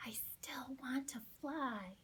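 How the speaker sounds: a soft unvoiced whisper, turned away from the song. Little chick whispering her wish to the sky. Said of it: "she whispered".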